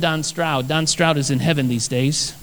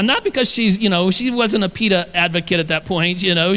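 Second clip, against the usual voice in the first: ordinary delivery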